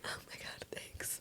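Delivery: tiny whispered reply; barely audible, acting out humble thanks; bashful thanks. Bashful reply to coworkers' praise.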